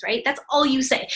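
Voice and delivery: usual speaking voice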